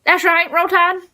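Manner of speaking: high, loud hammed-up Alabama twang; proud mock Southern cheer. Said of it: "we'll be like".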